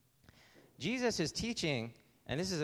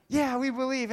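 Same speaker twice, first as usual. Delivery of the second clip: high, loud cheer of agreement; eager, raised-voice response; excited crowd reply; 11 semitones higher, 8 dB louder than usual. Acted. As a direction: shout back like an eager crowd. Excited crowd answers the daredevil.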